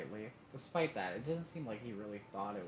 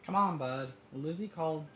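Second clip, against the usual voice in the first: loud, higher-pitched coaxing; coaxing call; 7 semitones higher, 8 dB louder than usual. A narrator's reading. Dad urging Cove to take Lizzie's call, coaxing. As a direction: urge him along with raised voice. Dad coaxes son to phone.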